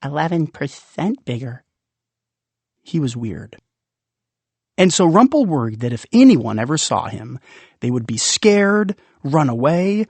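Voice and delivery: plain reading voice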